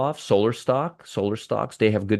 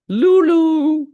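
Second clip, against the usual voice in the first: high, loud, drawn-out hoot; playful call-out; 6 dB louder than usual. Real, not acted. Teasing viewers with a stock ticker, high drawn-out call-out. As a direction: sing it out way up high, held long.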